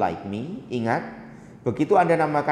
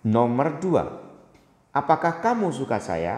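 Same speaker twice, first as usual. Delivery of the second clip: ordinary talk, nothing marked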